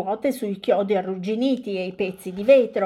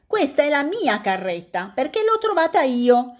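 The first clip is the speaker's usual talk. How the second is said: higher, insistent claim of ownership; possessive protest; 6 semitones higher, 5 dB louder than usual. A narrator's reading. Cart's finder to his friends, insisting with rising pitch. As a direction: stake your claim, pitch climbing as you go.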